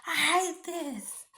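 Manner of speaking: imitating a worn-out believer who's had enough; fed-up outburst. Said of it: "you're gonna be like".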